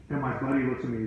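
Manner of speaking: ordinary talk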